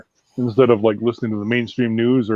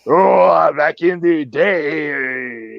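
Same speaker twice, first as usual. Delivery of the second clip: drawn-out Montreal French caricature; mock Montreal French; much slower than usual. Explaining to listeners what Montreal French is like, mock Montreal accent. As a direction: stretch it out in exaggerated Montreal French. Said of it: "Montreal French is just kinda like".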